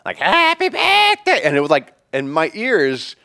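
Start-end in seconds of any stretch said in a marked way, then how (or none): 0.2-1.4 shrill, loud, drawn-out screech; imitating a man's piercing birthday shriek; deranged shriek. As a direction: shriek it like a deranged clown show. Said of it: "most middle-aged dude shrieking happy birthday".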